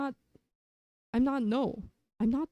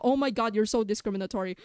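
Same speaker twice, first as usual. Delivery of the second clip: ordinary delivery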